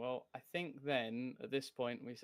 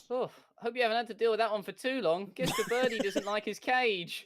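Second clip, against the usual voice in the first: high, loud and fast character voice; Ross's voice; 9 semitones higher, 7 dB louder than usual. Acted. GM voicing Ross to the party, high and fast. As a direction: pitch it way up and rattle it off quickly. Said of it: "Ross goes".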